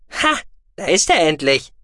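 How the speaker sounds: high, loud creature voice; squeaky, impatient exclamation; excited small creature. A narrator's reading. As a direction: cry out in a high little voice. Small creature friend exclaims at him.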